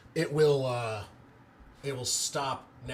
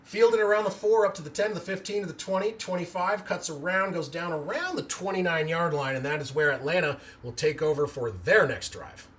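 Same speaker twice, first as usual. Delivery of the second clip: live play-by-play; 4 semitones higher than usual. Acted. Streamer calling the kickoff return live for chat, building play-by-play. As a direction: call the return yard by yard as it happens.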